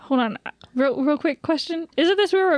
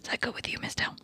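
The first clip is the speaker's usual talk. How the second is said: whispering; 12 dB quieter, faster than usual. Acted. Whispered question.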